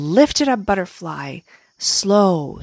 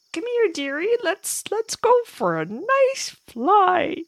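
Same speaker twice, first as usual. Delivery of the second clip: put-on old lady ant voice; 13 semitones higher than usual. Older ant speaks.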